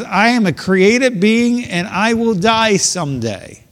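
voicing David. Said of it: "He says".

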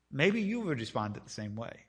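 ordinary talk

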